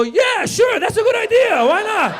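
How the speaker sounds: high-pitched shouting, hammed up; frantic, loud yes-man yell; over-eager agreement. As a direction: shriek your fake enthusiasm. Frantic fake agreement to Mitzi.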